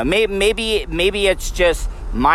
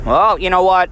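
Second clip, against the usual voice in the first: ordinary delivery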